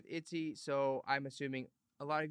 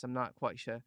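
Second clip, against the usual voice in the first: ordinary talk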